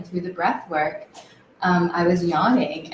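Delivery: ordinary talk, nothing marked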